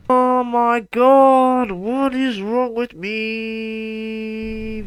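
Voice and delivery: high, drawn-out despairing groan; exasperated wail. Real, not acted. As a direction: stretch it out like you can't believe it. Rocket keeps flipping, exasperated.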